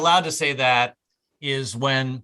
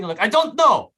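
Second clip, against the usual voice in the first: higher, louder put-on foreign voice; irritated foreign attendant; 6 semitones higher, 5 dB louder than usual. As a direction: cut the customer off in mock irritation. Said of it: "expect them to speak English".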